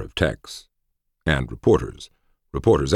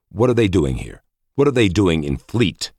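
insistent, raised questions; excited questioning; 5 semitones higher, 4 dB louder than usual. A narrator's reading. Man seized by a sudden realization, excited. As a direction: press the point, getting higher and louder.

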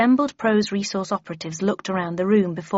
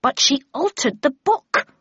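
higher-pitched, mildly angry objection; angry protest; 7 semitones higher, 4 dB louder than usual. A narrator's reading. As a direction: cry out in objection. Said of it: "cried Tweed angrily".

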